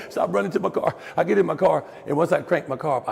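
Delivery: ordinary talk, nothing marked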